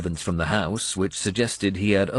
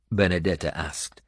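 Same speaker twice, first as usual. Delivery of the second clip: usual speaking voice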